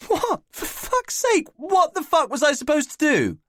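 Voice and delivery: high, strained disbelieving yelp; outraged cry. Acted. Alec protesting to Nick, high outraged yelp. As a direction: squeal it in outrage.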